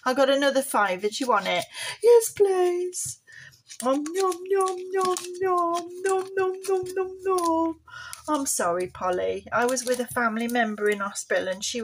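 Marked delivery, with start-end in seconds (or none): 2.0-3.2 high puppet voice. Pretend. Puppet Polly accepts fiver. 3.8-7.8 puppet munching. Pretend. Puppet eating the fiver.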